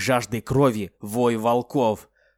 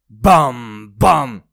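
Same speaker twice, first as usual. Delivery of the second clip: loud booming bang; 10 dB louder than usual. Acted. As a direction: shout out the impacts. Loud bangs imitated.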